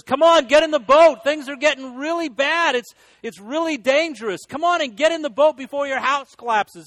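desperate neighbor. Retold or imitated. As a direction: beg him to get in the boat before it's too late. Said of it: "His neighbor is just desperate".

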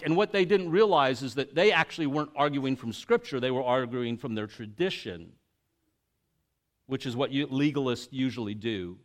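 normal talking voice

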